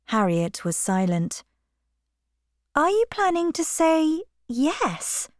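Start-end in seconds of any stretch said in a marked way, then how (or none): 2.7-5.3 a touch of surprise, lifted higher; gently taken aback; mild surprise. Acted. Emma asking Harriet if she'll accept, mildly surprised. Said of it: "continued Emma in surprise".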